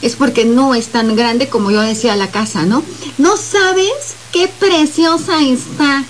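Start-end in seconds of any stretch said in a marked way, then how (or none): 3.2-6.0 high, slow, gushing fake praise; feigned delight. Shown as a demonstration. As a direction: gush over it without meaning a word. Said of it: "también me cambia el gesto de la voz". (elogio fingido, agudo y lento)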